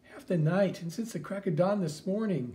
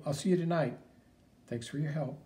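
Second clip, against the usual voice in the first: ordinary talk, nothing marked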